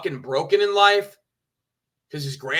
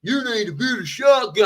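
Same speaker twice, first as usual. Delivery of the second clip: higher, mocking hillbilly voice; hillbilly impression; 5 semitones higher than usual. Streamer mimics co-host's impression of him, mock hillbilly. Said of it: "Is that your hillbilly impression?".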